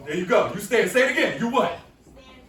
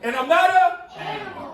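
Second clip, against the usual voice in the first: with attitude; 9 semitones higher, 5 dB louder than usual. Shown as a demonstration. Teacher leads the chant with laughing attitude. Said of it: "Come on, say it with an attitude".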